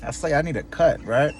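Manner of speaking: ordinary delivery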